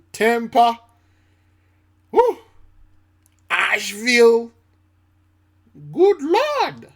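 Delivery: high, loud Good Lord; exclaiming. Real, not acted. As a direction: cry out in disbelief. Exclaiming over storm-hit places.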